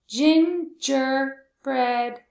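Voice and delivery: GINGERBREAD slow and firm as "gin, ger, bread"; syllable by syllable. Shown as a demonstration. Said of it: "How many syllables is that?".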